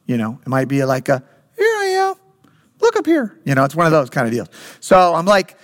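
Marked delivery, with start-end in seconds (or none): 1.5-3.4 very high falsetto call; playful hint. Retold or imitated. Hiding dad cueing his little daughter to find him, high and playful. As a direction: give a tiny teasing clue in a squeaky high voice. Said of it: "I'll give her a little cue".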